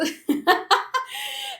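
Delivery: high burst of laughter; high-pitched happy laugh; delighted laugh. Real, not acted. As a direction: laugh out at the good news. Laughing at the retold news.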